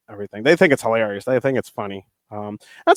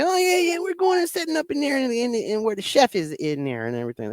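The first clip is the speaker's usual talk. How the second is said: much higher-pitched put-on character voice; Travis Norbert; 12 semitones higher than usual. Shown as a demonstration. Wrestler fooling the support staff, high character voice. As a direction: play along in a high, odd character voice. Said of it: "I would just play into it".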